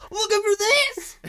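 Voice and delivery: high-pitched, soft cowboy taunt. Acted. Cowboy with a lasso taunts from the hilltop, high teasing call. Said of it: "cowboy standing there ... lasso going".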